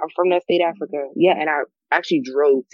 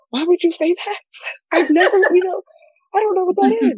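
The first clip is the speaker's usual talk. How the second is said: high, louder indignant squeal; mock denial; 4 dB louder than usual. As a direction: play offended innocence, way up high. Acting fake denial of being American.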